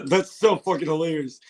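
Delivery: ordinary talk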